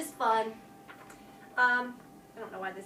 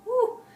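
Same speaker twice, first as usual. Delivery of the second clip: tired whoop; 12 semitones higher, 9 dB louder than usual. Shown as a demonstration. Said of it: "I'm tired".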